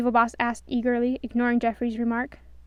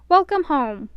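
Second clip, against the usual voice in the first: Doc's hearty welcome; 4 semitones higher, 8 dB louder, slower than usual. A narrator's reading. Doc welcomes Snow warmly.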